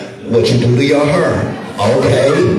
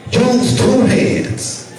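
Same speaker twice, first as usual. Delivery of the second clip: very slow, sung, higher come-on; seductive drawl; 8 semitones higher, much slower than usual. Retold or imitated. Husband coaxing his wife in bed, slow and sung. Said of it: "I was like".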